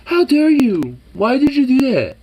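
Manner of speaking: high, loud outraged dog voice; indignant dog. Retold or imitated. Dog scolding owner for stepping on paw, high loud. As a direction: voice the dog's shocked outrage. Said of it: "look at me like".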